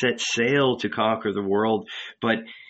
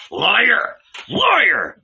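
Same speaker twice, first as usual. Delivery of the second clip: high, loud shouts of accusation; furious shout; 10 semitones higher, 6 dB louder than usual. A narrator's reading. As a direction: shout it in rising fury. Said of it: "he shouted".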